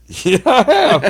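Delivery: high, loud, laughing through the words; giggly confession; laughing admission. Retold or imitated. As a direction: own up while cracking up. Admitting drinking to the cop.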